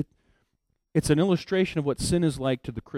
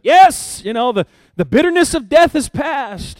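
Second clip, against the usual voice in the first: much higher, louder, chirpy relief; cheerful; 11 semitones higher, 12 dB louder than usual. Retold or imitated. Agag greeting Samuel, cheerful and sure he is spared. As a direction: sing it out like the danger is over. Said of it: "he comes cheerfully".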